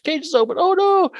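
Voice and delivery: high mock alarm; mock panic. Host voicing circus staff, hammed-up high panic. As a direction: gasp it out like a panicked circus hand.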